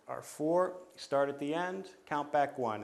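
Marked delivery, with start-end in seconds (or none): none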